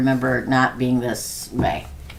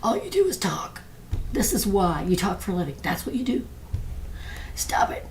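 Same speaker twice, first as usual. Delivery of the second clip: lightly voiced mock doctor, higher, ending in a whisper; scolding doctor; 5 semitones higher, 7 dB quieter than usual. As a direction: tease the doctor's nagging, half whispered. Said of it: "my doctor's always like".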